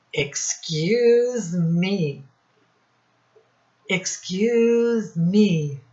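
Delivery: slow, drawn-out excuse me; slow polite excuse me. Acted. Teacher politely excusing a pretend burp. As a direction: stretch it out politely.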